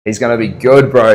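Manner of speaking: ordinary delivery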